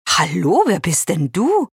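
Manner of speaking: mildly curious question, lifting at the end; a touch of curiosity. A narrator's reading. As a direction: ask with friendly interest. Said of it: "fragt Shira neugierig".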